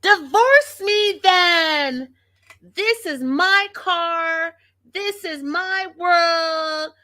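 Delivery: high, drawn-out imitated shriek; retold scream. Vlogger imitates wife claiming the car, slow mock scream. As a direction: mimic her yelling, stretching the words slowly. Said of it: "she's screaming".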